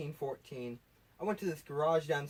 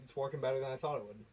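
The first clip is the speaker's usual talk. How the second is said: ordinary delivery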